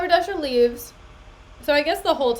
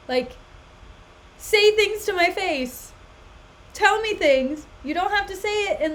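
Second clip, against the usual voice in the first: higher, louder angry outburst; retold angry outburst; 4 semitones higher, 3 dB louder than usual. Retelling shouting at a partner who treated her like a child, angry outburst. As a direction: erupt at them, fed up with being babied. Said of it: "erupted in anger and was like".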